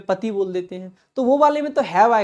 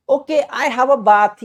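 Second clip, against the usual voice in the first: louder, slow mock-silly "Okay, I have a bath"; mock wrong usage; 5 semitones higher, 6 dB louder, much slower than usual. Teacher mocking a wrong answer, loud and slow. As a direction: ham up the wrong sentence. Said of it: "ऐसे कहोगे?".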